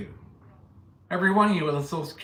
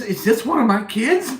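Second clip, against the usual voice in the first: raised, louder panicked wondering; alarmed thought; 8 semitones higher, 8 dB louder than usual. Retold or imitated. Recalling a girl at his door, a loud alarmed aside. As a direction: gasp it out like a sudden fear. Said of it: "my first thought was".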